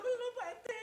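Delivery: high tearful whine; mock whimper. Wife whining to the RT, mock tearful.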